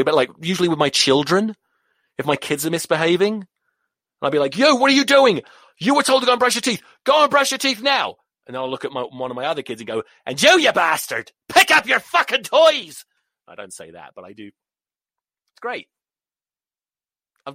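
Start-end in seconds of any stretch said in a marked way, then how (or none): 4.6-8.2 high, loud parental scolding; telling-off. Acted. Acts out telling off his kids. 10.2-13.0 high, loud Irish scolding; Irish accent. Acted. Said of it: "in an Irish accent".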